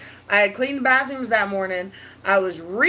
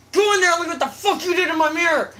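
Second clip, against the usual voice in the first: fast, higher mock scolding; angry order; 6 semitones higher, much faster than usual. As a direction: rattle it off like an angry stepmom. Retold angry stepmom giving orders.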